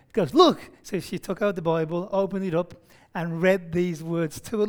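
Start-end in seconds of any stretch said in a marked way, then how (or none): 0.3-0.7 high, loud, clipped Look; mock sharp command. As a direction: bark out Look at the puzzled visitor. Frustrated builder snaps back.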